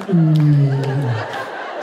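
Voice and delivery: imitated deep, sliding "mm"; long low hum, mimicking a late-night host; low radio-host hum. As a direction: let a very low hum drop away. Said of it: "Just at different intonations".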